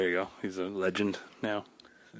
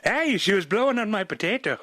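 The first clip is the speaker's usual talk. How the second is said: high, loud hammed-up Irish accent; mock Irish brogue, pitched way up; 10 semitones higher, 4 dB louder than usual. Joking about potatoes with cohost, in a hammed-up Irish accent. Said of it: "That's my best Irish accent".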